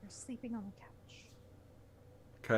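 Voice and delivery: hushed unvoiced whisper. Real, not acted. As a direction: breathe it out as a whisper. Tired reader begging to stop.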